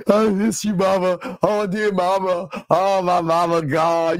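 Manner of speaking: lightly faked crying for mama, laughing through it; mock sobbing son. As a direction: ham up a weepy man missing his mama, half-joking. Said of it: "Hey, guys, get this".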